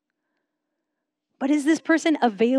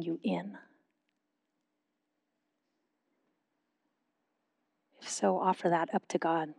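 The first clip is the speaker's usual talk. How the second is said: measured prayerful questions, long pauses between phrases; slow guided reflection. Real, not acted. Pastor leading a congregation in silent reflection before God, slow and measured. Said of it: "I'll say them slowly".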